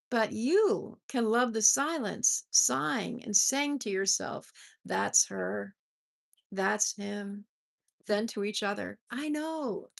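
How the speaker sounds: measured, lifted poetry reading voice; poem reading. Acted. Host reading a love poem to her viewers, tenderly. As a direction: read each line like a gift.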